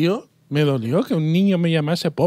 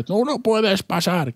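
mock wizard; 5 semitones higher, slower than usual.

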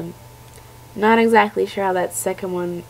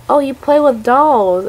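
mocking quote; 5 semitones higher, 6 dB louder than usual. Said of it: "people being like".

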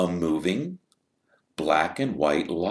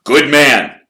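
high, loud, hearty team captain; rally the team with gusto; 9 semitones higher, 12 dB louder than usual. Acted. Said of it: "said Wood".

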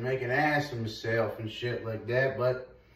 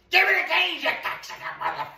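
mock yell; much faster than usual. Yelling at game enemy.

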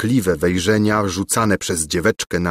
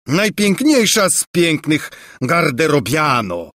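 a put-on doting king's voice, higher; amorous old king; 6 semitones higher than usual. A narrator's reading. King wooing the maid, doting. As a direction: flatter her grandly.

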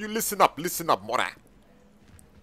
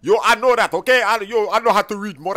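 raised, higher-pitched retort; defensive irritation; 4 semitones higher, 6 dB louder than usual. Acted. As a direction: snap back at being corrected. Defensive after being corrected.

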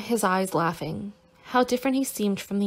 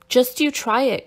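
raised, louder dare; defiant cry; 4 semitones higher, 7 dB louder than usual. A narrator's reading. Vladimir daring the commissar to use force, defiant cry. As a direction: cry it out higher, as a challenge. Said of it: "cried Vladimir, his eyes flashing".

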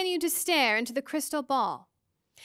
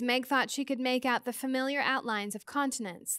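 ordinary delivery

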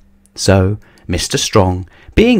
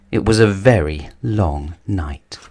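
ordinary delivery, nothing marked

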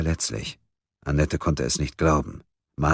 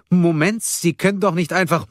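high, loud nurse's objection; alarmed protest; 13 semitones higher, 4 dB louder than usual. A narrator's reading. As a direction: protest in alarm as he pushes past. Alarmed nurse tries to stop Munk. (aufgeregt protestieren, hohe Stimme)

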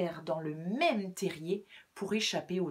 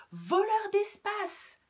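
high, slow shouted retort; 13 semitones higher, 6 dB louder, slower than usual. Acted. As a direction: fling the insult right back. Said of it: "répliquait monsieur Grisou".

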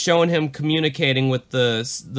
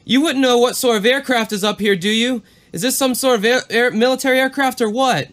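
higher and louder, rushed at times; alarmed pilot; 7 semitones higher, 4 dB louder than usual. Retold or imitated. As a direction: plead with ground like you're scared. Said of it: "Back to the exchange".

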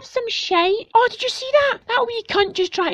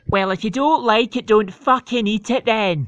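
a much lower, gruff mum voice; mum's voice; 10 semitones lower than usual. Acted. Mum to her complaining husband, low gruff voice. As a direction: snap back as the fed-up mum.

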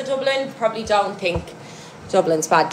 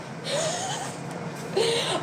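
high, quiet laugh; soft laughter; 10 dB quieter than usual. Real, not acted. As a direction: laugh softly, high-pitched. Laughs after Dundalk rant.

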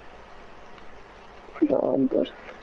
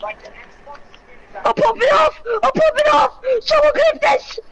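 hyped shouting; 12 semitones higher, slower than usual. Real, not acted. Gamer celebrating kills to his friend, shouting hyped. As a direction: scream it like you just won the fight. Hyped mid-game win.

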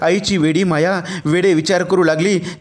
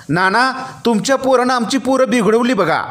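higher-pitched irritated accusation; raised voice; 8 semitones higher than usual. A narrator's reading. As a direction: raise the voice in an annoyed complaint. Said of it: "इतक्या चढ्या आवाजात".

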